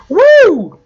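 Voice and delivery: high, loud "woo"; excited whoop. Real, not acted. Player whooping through a rope swing, excited whoop. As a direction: let out a shrill thrill-ride whoop. Thrilled by a game stunt.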